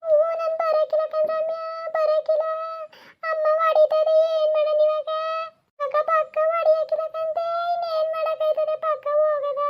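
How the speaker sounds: put-on tiny child pleading with her big sister; little girl voice.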